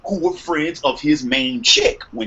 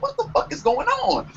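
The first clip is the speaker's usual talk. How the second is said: startled, pitched way up; squeaky what-the-hell surprise; shocked disbelief; 5 dB quieter than usual. Retold or imitated. In the dorm, hearing girls run down the hall: high, startled disbelief. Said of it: "I was like".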